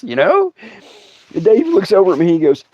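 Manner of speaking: ordinary talk, nothing marked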